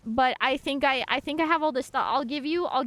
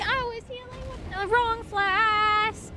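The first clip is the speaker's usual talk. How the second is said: high, slow whine again; high whiny repeat; 10 semitones higher, slower than usual. Real, not acted.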